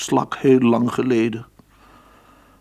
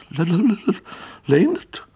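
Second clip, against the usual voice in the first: high, louder stammer on the first sound; 10 semitones higher, 4 dB louder than usual. A narrator's reading. Hein recognising his friend Leendert, stammering. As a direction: stutter the name out in disbelief. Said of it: "stamelde Hein".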